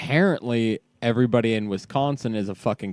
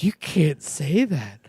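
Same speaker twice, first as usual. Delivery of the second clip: awestruck; slower than usual. Retold or imitated. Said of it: "she's awestruck and goes".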